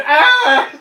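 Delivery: high, loud scream; mock pained yell. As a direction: yelp as if shot. Player yells at getting shot.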